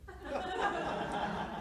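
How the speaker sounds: a high, soft laugh at the punchline; short amused laugh; burst of laughter. Real, not acted. Laughing at the story.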